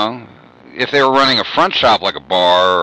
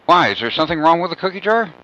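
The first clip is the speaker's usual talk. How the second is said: put-on innocent kid; 8 semitones higher, faster than usual. Kid denying to mom.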